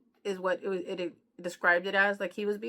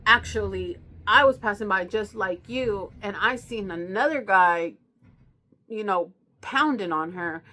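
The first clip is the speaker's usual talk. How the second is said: loud made-up story; 8 dB louder than usual. Retold or imitated. Jerry invents a second story.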